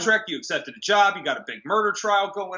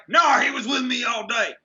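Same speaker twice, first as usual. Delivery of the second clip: mock dismissive shout; 5 semitones higher than usual. Clueless husband brushing off his wife, mock shout. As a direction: toss it off with a mocking shout. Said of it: "Don't be like".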